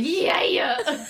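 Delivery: high, loud [name] yell; [name] impression. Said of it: "I'm over there like".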